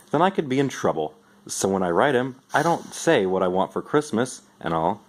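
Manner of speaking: usual speaking voice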